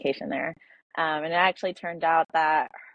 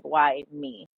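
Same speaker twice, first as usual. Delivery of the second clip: ordinary delivery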